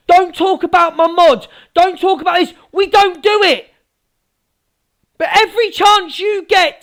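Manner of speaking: high, relentless angry rant; raging. Real, not acted. Accusing Angie's lot of putting them down, raging. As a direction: pile up the accusations in real indignation. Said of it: "I'm absolutely fucking raging".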